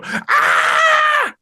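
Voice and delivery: high-pitched gleeful scream, imitating a small child; excited toddler shriek. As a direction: shriek like a toddler thrilled to see dad.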